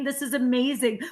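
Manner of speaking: usual speaking voice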